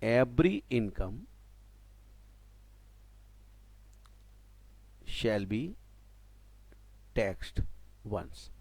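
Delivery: very slow, word by word, as if dictating; slow dictation. Shown as a demonstration. Teacher dictating a golden rule for the class to copy, very slowly. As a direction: give each word time for students to write it down. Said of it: "dictating rule to students".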